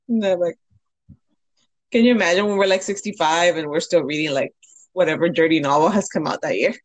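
ordinary delivery

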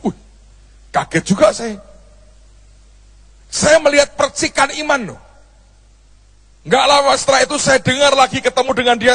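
usual speaking voice

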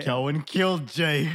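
loud, slow mock-dramatic reveal; hammed-up revelation. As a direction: deliver it like a trailer twist.

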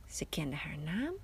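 hushed narrator whisper; a soft whispered aside to the listener. A narrator's reading. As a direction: whisper it like a secret. Narrator hushes a mysterious moment.

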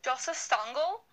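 Joseph Stangl in a put-on German accent, Stangl with a broad ah. Shown as a demonstration. Asked by her co-host to say the bishop's name in a German accent. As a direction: say Joseph Stangl like a German would, Stangl rising at the end. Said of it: "Say it with a German accent".

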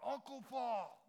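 put-on high, loud eager little kid calling out; little boy's voice. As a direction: call him like an excited five-year-old. Little boy greets the speaker.

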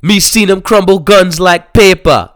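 high, loud Jamaican gangster voice; Jamaican accent. Retold or imitated. Jamaican dude asking about Luke Cage, Jamaican accent. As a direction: ask it like a Jamaican dealer. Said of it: "that's how they talk about this man".

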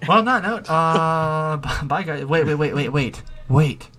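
loud. Real, not acted. Rushing to stop the outro.

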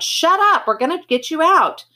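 high, loud yell over the roar. A narrator's reading. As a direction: yell to cut through the screaming. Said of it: "Johnny yelled".

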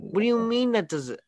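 high-pitched, incredulous challenge; exasperated disbelief. Real, not acted. Host disputing co-host's logic about release dates, exasperated. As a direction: voice leaping up in real frustration.

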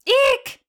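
very high shrill eek like a horror violin; shrieking eek. Pretend. Mimicking a slasher film stab for the co-host, high squeal. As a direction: shriek it like the Psycho strings.

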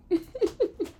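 high, light giggle; real little high laugh at yourself; sheepish giggle. Real, not acted. Podcaster after begging listeners' mercy, sheepish giggle.

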